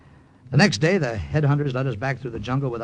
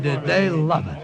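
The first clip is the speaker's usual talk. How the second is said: ordinary delivery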